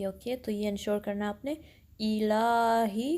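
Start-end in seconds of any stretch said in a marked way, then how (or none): 2.0-3.1 इलाही with a sharp opening hamza and ला held for two counts; sharp hamza, long la. Shown as a demonstration. Teacher models इलाही, slow with a lengthened ला. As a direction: recite इलाही slowly, stretching ला. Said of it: "ला को टू counts लंबा करना है".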